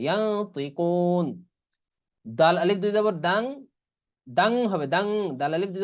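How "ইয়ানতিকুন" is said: ইয়ানতিকুন very slow, ন as nasal ইয়াং; slow ikhfa demo. Shown as a demonstration. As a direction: stretch ইয়াং before তি. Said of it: "ইকফা গুন্না করতে হবে।". (ধীরে গুন্নাসহ ইয়ানতিকুন)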